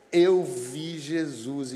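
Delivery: slow, drawn-out declaration; quoted witness announcing the news; proclaimed testimony. Retold or imitated. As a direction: proclaim it slowly, stretching the words. Quoting the woman's joyful testimony.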